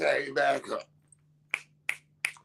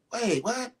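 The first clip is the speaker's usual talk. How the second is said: mock surprise; 7 semitones higher than usual. Mock double take.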